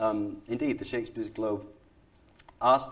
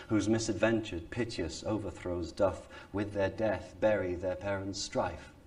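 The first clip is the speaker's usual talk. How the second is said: slightly exaggerated RP, measured stage delivery; received pronunciation. Shown as a demonstration. Actor opening Romeo and Juliet for an audience, received pronunciation. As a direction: recite verse in careful Received Pronunciation. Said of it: "the speech in received pronunciation".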